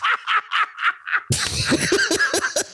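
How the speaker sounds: howling laugh; high laugh. Real, not acted. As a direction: burst out laughing at the punchline. Laughs at the punchline.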